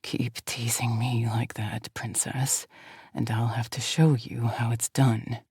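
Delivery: low slow hissed whisper; hissing voice. Acted. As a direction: hiss it like dragged over coals. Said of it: "a voice that hisses".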